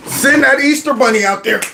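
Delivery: ordinary delivery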